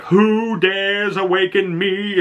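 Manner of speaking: slow, high, eerie spirit voice; a spooky voice waking from sleep. Retold or imitated. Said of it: "she awakens all spooky like".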